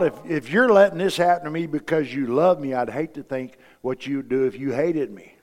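ordinary delivery

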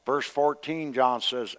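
ordinary talk

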